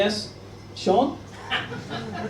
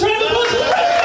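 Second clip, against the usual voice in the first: calling out; 5 dB louder than usual. Real, not acted. Calls for applause on stage.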